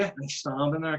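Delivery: normal talking voice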